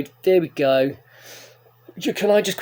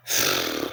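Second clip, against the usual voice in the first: relieved sigh; 5 dB quieter than usual. Real, not acted. Relieved after trip.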